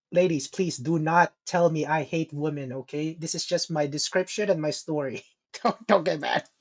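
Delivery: ordinary talk, nothing marked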